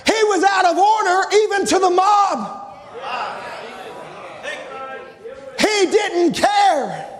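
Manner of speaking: high, shouted exhortation with drawn-out words; impassioned revival preacher's holler; full-force preaching. Real, not acted. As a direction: urge the congregation on at the top of the voice.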